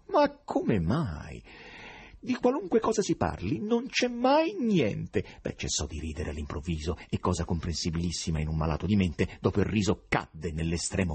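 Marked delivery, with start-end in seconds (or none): 0.1-5.2 high, odd foreign professor voice, exasperated; eccentric professor. Acted. Foreign professor grumbling at the writers, high odd voice. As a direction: complain like a strange foreign scholar.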